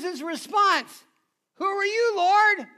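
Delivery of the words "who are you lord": high, loud, awestruck call to the Lord; awed cry. Retold or imitated. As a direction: cry out in fearful wonder. Saul answers the heavenly voice.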